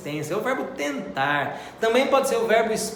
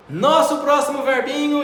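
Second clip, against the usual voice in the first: excited announcement; 8 semitones higher, 5 dB louder than usual. Real, not acted. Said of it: "announces next verb".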